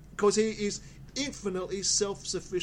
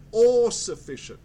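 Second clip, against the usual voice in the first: "all" punched loud in "all sufficient"; "all" hit with force, then "sufficient" eased off; stress on ALL; 7 dB louder than usual. Real, not acted. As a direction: lean hard and loud on the word "all". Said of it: "I want to stress that word".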